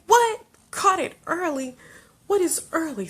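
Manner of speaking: high, loud disbelieving what; shocked mother asking doctors what early means; incredulous what. Retold or imitated. Disbelieving doctors, high and loud. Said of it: "And we're like".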